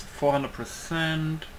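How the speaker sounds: normal talking voice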